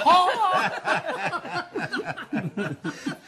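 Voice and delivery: long high cackling laugh; gleeful high laugh; hearty laugh. Acted. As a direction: break into a long, high laugh at his own prank idea. Imagining the second kobold.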